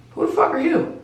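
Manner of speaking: high, alarmed demand; shocked at a stranger; startled demand. Retold or imitated. Confronting a strange man at the top of the steps, high alarmed.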